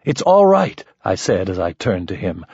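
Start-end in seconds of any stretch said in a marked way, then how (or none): 0.0-0.8 urgent reassurance. Acted. Calming Mike.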